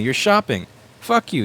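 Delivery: ordinary talk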